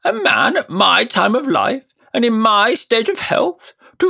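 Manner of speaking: high, loud, scandalised uncle; indignant old uncle. Acted. Uncle scolding the boy, high and indignant. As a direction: huff at a preposterous idea.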